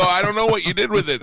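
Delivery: high, loud comic exasperation; imitating a frustrated phone shout. Customer struggling to make driver understand, exasperated high shout.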